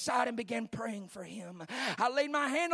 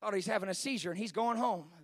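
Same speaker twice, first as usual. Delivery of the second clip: ordinary talk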